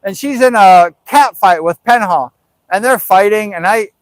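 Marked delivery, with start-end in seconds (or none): none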